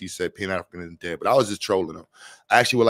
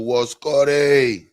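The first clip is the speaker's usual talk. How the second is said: drawn-out greeting; 5 semitones higher, 6 dB louder, much slower than usual. Acted. Greeting viewers by name.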